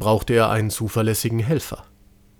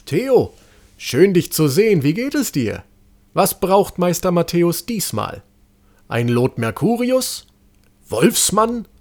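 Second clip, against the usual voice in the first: higher, louder, chirpy shopkeeper; cheerful apothecary voice, higher and louder; friendly apothecary; 7 semitones higher, 3 dB louder than usual. A narrator's reading. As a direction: greet the boy brightly, eager to sell. Apothecary greets Theo.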